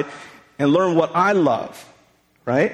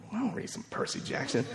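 breathy whisper under his breath; dad grumbling to himself about his daughter's books, whispered; whispered aside; 3 semitones higher, 15 dB quieter than usual. Retold or imitated. Private reluctant reaction.

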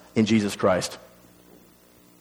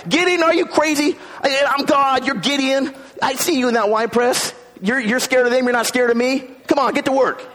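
higher, impatient scolding from God; exasperated God; 12 semitones higher than usual. Retold or imitated. God scolding a scared Gideon, higher and impatient. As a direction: play God fed up and scolding. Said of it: "He could be like".

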